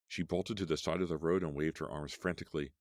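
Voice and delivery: usual speaking voice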